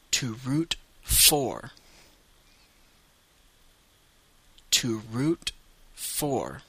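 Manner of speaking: to root for, slow and clear; to root for, slow teacher model. Shown as a demonstration. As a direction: stretch to root for for learners. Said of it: "deux fois en anglais".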